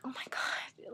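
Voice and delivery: breathy whispered gasp of shock; stunned whisper to yourself; hushed disbelief. Retold or imitated. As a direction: whisper it like you can't believe it. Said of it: "and I was like".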